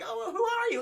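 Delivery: high-pitched startled question; high, retold damsel voice; mock startled woman. Voices frightened Alice meeting stranger.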